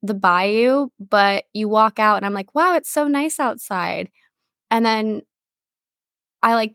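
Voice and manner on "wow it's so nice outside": higher-pitched, lightly amazed; retold wonder. Telling how the bayou room fooled her, mild wonder. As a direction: imitate your own impressed reaction.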